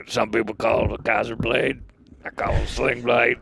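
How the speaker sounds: a light take on the Sling Blade man; Sling Blade quote. Retold or imitated. Quoting a movie to a podcast friend, light impression.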